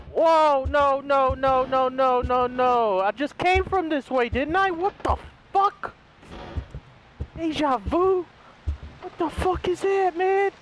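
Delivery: panicking, voice jumps up; panicked high yelp. Real, not acted. Panics in looping hallway.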